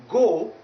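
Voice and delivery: "go" as "gaw"; pure vowel seven. Shown as a demonstration. Teacher showing the wrong vowel, pure o. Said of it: "vowel number seven".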